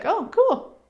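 high, light "Oh, cool"; breezy unbothered. Retold or imitated. Meeting judgment, breezy and unbothered. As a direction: shrug it off brightly. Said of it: "You're just like".

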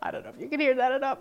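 normal talking voice

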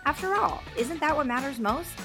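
polished announcer read over music; podcast intro read. Acted. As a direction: read it like a show opener.

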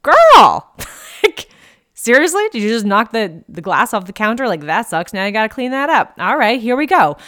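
put-on high, loud teasing girlfriend voice, laughing in it; sassy funny voice. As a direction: tease yourself like a sassy bestie. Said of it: "or you could be like".

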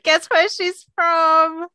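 high, slow, drawn-out teasing question; teasing challenge. Retold or imitated. Pub regular goading strangers to place her accent, teasing. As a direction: draw it out like a quiz challenge. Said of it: "one of my favourite pastimes".

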